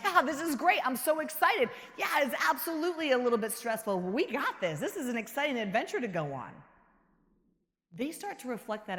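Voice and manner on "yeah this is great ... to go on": loud, high, bright-eyed cheer; forced excitement. Pretend. Leader hyping up the team, forced excited cheer. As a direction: pump them up with fake enthusiasm.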